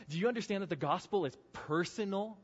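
usual speaking voice

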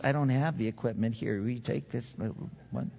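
low, soft, helpless dad excuse; mock excuse. Husband handing baby to wife, lightly voiced excuse. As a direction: plead your way out of the diaper, softly. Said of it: "we use that excuse".

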